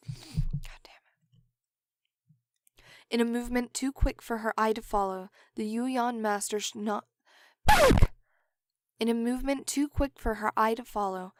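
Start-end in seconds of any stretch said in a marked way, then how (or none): 0.1-2.8 frustrated under-the-breath curse; whispered curse. Real, not acted. Frustrated blooper after missed line. 7.7-8.1 sudden loud high curse at a mistake; frustrated yell. Real, not acted. Blooper after stumbling again.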